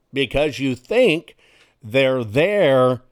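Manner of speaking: normal talking voice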